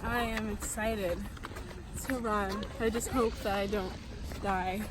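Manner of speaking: slow, quiet, no enthusiasm at all; deadpan, joyless answer; unenthusiastic. Real, not acted. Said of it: "the most unenthusiastic answer".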